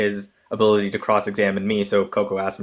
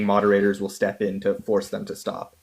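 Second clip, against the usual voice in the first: normal talking voice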